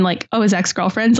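ordinary talk

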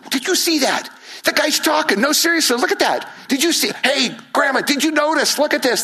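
high, amazed chatter in the crowd; excited crowd. Retold or imitated. Crowd member to neighbours and Grandma, high amazed excitement. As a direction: gasp and point it out to everyone. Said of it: "People going".

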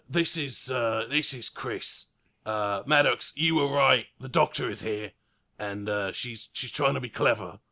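lightly voiced gunman on a walkie-talkie; radio report. Gunman radioing his boss, lightly voiced report. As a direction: report in over the radio, clipped. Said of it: "pulls out a walkie-talkie radio and says".

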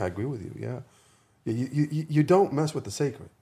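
usual speaking voice

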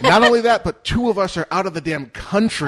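loud, high exasperated outburst; exasperated shout. Real, not acted. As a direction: shout it out in disbelief.

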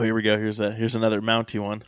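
ordinary talk, nothing marked

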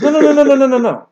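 high, loud eager quote; a keen fan recalling the match; retold eager voice; excited Dean Lister. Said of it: "He goes".

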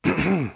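pointed, attention-seeking throat clearing; conspicuous throat clear. Acted. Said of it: "Kate cleared her throat very conspicuously".

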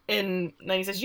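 normal talking voice

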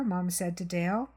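ordinary delivery

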